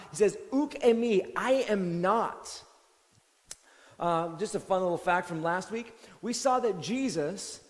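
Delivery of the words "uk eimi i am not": slow Greek "Uk eimi" then a firm "I am not"; strong denial. Retold or imitated. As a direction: press every word of the denial. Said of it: "He responds strongly".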